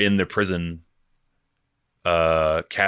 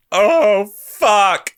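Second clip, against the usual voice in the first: overwhelmed outburst; 13 semitones higher, 7 dB louder than usual. Real, not acted. Overwhelmed by the monologue.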